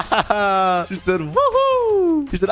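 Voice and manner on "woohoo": very high, long woohoo; celebrating whoop. Acted. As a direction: let out a squealed victory whoop. Agent cheering.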